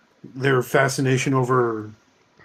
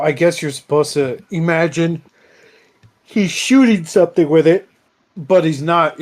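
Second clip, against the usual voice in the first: usual speaking voice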